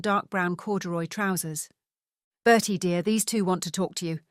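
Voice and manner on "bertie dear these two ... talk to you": ordinary delivery, nothing marked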